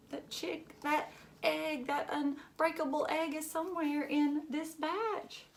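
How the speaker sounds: put-on lively read-aloud for children; storybook reading. A narrator's reading. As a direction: read it like a picture book to a class. Reads picture book aloud to children.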